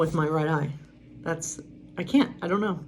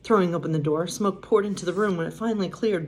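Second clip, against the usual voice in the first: usual speaking voice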